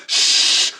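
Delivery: sharp voiceless "shh"; firm shush. Retold or imitated. Orb silencing his scream, firm shush. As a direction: cut off the scream with a full shush.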